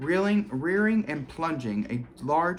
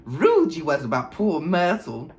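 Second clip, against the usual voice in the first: impish voice, higher and louder; mischievous poltergeist; 4 semitones higher, 5 dB louder than usual. A narrator's reading. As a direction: tease like a gleeful imp. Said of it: "said Peeves, his eyes dancing".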